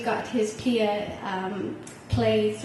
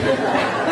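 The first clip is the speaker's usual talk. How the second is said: breathy burst of laughter at her son's words; real laugh; 3 semitones higher, 6 dB louder than usual. Real, not acted. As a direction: laugh out loud, delighted. Mother laughs retelling son's advice.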